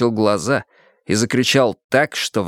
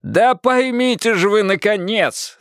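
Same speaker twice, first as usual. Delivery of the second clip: straining shout; 10 semitones higher, slower than usual. A narrator's reading. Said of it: "надрывался Пересолин".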